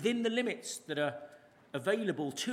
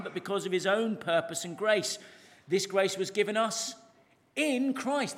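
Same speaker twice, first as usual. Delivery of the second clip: louder, measured reading of the verse; preacher reading a verse aloud; scripture reading; 3 semitones higher, 4 dB louder than usual. Acted. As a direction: proclaim the Bible text to the congregation.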